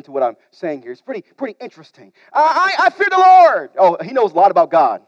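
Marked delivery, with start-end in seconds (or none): 2.3-3.7 louder, proud proclamation; emphatic boast. Acted. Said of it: "Jonah answers theologically".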